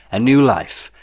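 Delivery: ordinary delivery, nothing marked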